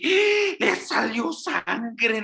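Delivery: high, loud alarmed cry over the blood; panicked parent. Retold or imitated. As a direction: play the frightened parent who just saw blood.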